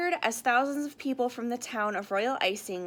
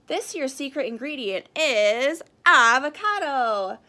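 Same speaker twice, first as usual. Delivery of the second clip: slow, louder reveal of avocado; big reveal; 2 semitones higher, 8 dB louder than usual. Acted. Kitchen director revealing the secret ingredient, slow and loud. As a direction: draw out the reveal for effect. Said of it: "Chocolate Chip continued".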